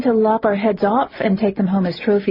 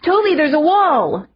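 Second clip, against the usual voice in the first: high startled yelp; yelped in surprise; 10 semitones higher, 4 dB louder than usual. A narrator's reading. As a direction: cry out suddenly. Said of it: "yelped in surprise".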